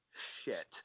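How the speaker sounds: whispered, breathy "shit" under the breath; caught-out curse, barely voiced; a hushed oh-no curse; muttered curse. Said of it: "then you hear a".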